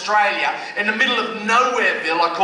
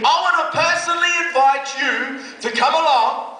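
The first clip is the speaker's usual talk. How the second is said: grand invitation; 6 semitones higher than usual. Retold or imitated. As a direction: stretch out the big reveal like a showman. Quoting the youth worker.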